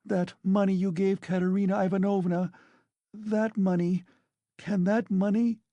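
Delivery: high, urgent and pressing; sudden anxious cry. A narrator's reading. Sonia pressing him about the money, high and urgent. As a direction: blurt it out, voice up high. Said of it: "she cried suddenly".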